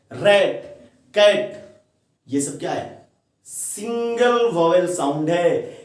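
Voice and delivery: ordinary talk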